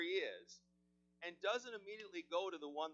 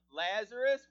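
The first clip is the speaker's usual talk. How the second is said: "Lazarus" shouted, much louder; loud call; 6 semitones higher, 15 dB louder than usual. Acted. Jesus calls Lazarus out of the tomb, shouting. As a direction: call "Lazarus" with full force. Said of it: "and he's speaking to you now".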